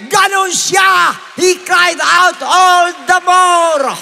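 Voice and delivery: high, very drawn-out preacher's shout; full-force shouting. Shown as a demonstration. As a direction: stretch the last words into a long cry.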